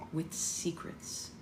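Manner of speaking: hushed, slow, secretive; soft. A narrator's reading. Finnick leaning in to Katniss, soft secretive hush. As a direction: breathe it softly like a secret. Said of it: "he says softly".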